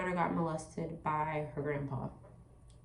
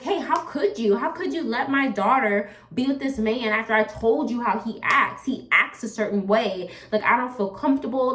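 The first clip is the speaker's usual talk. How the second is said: louder, higher, reenacted reproach; retold upset confrontation; 3 semitones higher, 6 dB louder than usual. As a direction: replay a heated protest at full push. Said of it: "I started boo-hooing".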